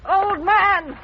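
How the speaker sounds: put-on loud, rising call of "old man". Wife calling loudly for the old man. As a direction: shout it out to reach him across the house.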